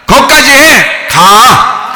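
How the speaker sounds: slow and firm; slow firm dismissal. Shown as a demonstration. Preacher showing how Jesus sends the storm off, slow and firm. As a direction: send it away slowly and firmly. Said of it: "그런 겁니다".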